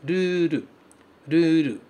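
"ruru," with a tapped R and a long final u; Japanese ruru. Shown as a demonstration. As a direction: model "ruru," with flap Rs and a long u. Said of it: "esse R do começo". ("ruru" com R batido e u longo)

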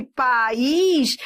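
PAÍS as pa-ís, long stressed Í; two syllables. Shown as a demonstration. Teacher explaining the trick question's hiatus, two syllables. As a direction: separate the A and Í in país. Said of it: "aí seria hiato".

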